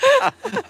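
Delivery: squealing laugh; high laugh. Real, not acted. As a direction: burst into a high shriek of laughter. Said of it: "That was great".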